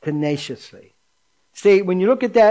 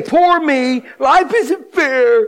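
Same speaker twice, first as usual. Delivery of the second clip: higher, slow, drawn-out whine of "poor me"; mock whining self-pity; 6 semitones higher, 3 dB louder, much slower than usual. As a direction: ham up a pitiful complaint, stretching it slowly. Pastor mimics a self-pitying complaint.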